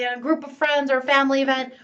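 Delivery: ordinary talk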